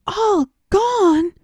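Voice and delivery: high, loud shocked cry; cried out. Acted. Mouse cries out at the cat's last name. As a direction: blurt it out in alarm. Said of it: "cried the mouse".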